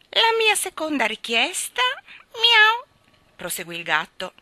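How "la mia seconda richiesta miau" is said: high, loud cat voice; demanding cat. Acted. Cat putting a second request to the king, demanding. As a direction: announce it up high, capped with a mew.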